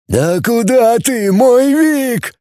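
high, drawn-out creature wail; slow, high-pitched plaintive wail; wailing humanoid. A narrator's reading. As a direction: howl it in a high put-on voice. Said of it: "взвыл семигуманоид".

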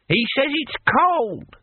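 put-on deaf old man relaying. Old barbarian wrongly relaying Rincewind's words, high and slow. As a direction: pass on the misheard words like an ancient warrior.

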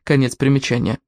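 plain reading voice

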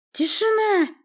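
high, loud, held-out call for silence; commanding shout. A narrator's reading. Sorceress silences the court from the throne, commanding shout. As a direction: shout the whole hall down. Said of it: "приказала Власта".

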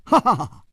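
high, easy ha-ha-ha; smiling laugh. A narrator's reading. Hero laughs without fighting back, high easy laugh. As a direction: laugh it off lightly. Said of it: "仍然带着微笑说".

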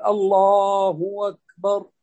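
long drawn الله, then أكبر as "wakbar"; hamza turned waw. Shown as a demonstration. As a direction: stretch الله and slide into أكبر on a w. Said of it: "يقلبون الهمزة هنا". (إبدال همزة "أكبر" واوا مع مد "الله")

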